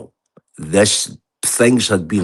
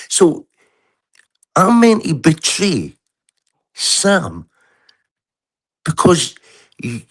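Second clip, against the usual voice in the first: angry rant; 5 semitones higher than usual. Real, not acted. Furious rant at Sheila.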